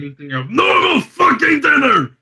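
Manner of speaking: high, very loud sustained yell; furious shout. Acted. Diner insisting everyone carry on eating, furious shout. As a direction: build to a full-throated scream. Exploding at the disrupted family dinner.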